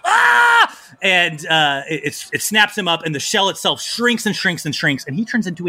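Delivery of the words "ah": loud, high cry of pain; pained yell. Acted. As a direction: yelp as if snapped in a trap. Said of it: "snaps him up as he goes".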